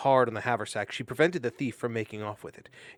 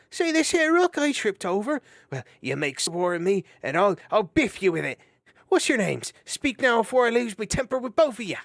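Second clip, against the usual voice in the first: blustering hedgehog; 11 semitones higher, 8 dB louder than usual. Acted. Bowley bristling threat.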